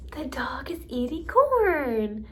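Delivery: slow singsong, CORN lifted and stretched; teacher repeating for a child, CORN drawn out; modeling with emphasis. Shown as a demonstration. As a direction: swoop up on CORN, then glide down. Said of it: "I'll model it one more time".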